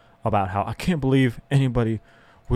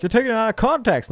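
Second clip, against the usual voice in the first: mocking objector; 9 semitones higher, faster than usual. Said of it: "and you're like".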